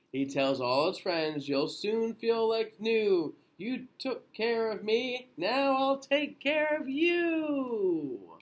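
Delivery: lilting storybook read-aloud; gentle bedtime picture-book reading; reading to children. A narrator's reading. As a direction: read it slowly to little ones.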